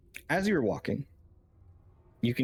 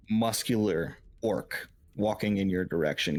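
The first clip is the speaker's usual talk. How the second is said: normal talking voice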